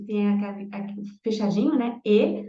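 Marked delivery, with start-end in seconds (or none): 2.1-2.4 Élève with the first E hit loud; Élève, first E forceful; strong first E. Shown as a demonstration. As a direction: punch the opening E of Élève.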